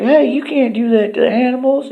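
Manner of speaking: high, loud squeaky protest; outraged cartoon. Turd shouting at the lion tamer, high and loud. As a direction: yell it up at the lion tamer like a tiny hero. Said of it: "He's like".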